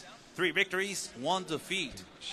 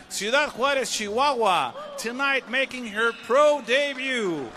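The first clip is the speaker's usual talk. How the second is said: long, sung-out name, shouted high; fighter introduction call; 7 semitones higher, 9 dB louder, much slower than usual. Acted. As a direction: stretch the name for the crowd.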